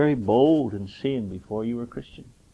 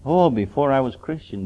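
ordinary talk, nothing marked

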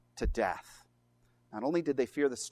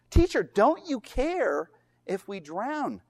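slow, higher-pitched reproach; accusing; 5 semitones higher, 4 dB louder, slower than usual. Retold or imitated. Disciples appealing to Jesus as they drown, accusing. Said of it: "they accuse him of apathy".

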